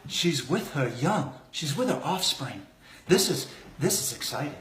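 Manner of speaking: hushed wildlife narrator voice; nature documentary. Acted. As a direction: whisper like stalking animals.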